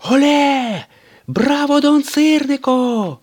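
very high, loud, slow cheering; crowd rejoicing. A narrator's reading. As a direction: cheer the hero from the stands. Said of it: "раділи глядачі".

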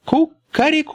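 high crowing cock-a-doodle-doo; rooster crow. A narrator's reading. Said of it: "закричал". (петушиное кукареку)

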